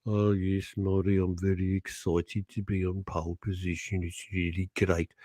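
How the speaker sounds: low, dreary racing-driver impression; Nigel Mansell. Racing driver replying to excited commentator, low deadpan impression. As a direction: answer excitement in a low, unexcited drone. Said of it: "when Nigel Mansell".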